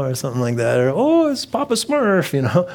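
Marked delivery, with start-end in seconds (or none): 0.9-2.3 very high, drawn-out delighted cry; hammed-up parade excitement; mock childhood wonder. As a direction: squeal it slowly like a kid spotting a balloon. Kid reacting to parade balloons.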